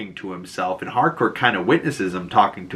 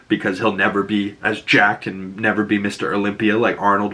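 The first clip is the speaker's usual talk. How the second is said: normal talking voice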